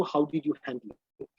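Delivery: normal talking voice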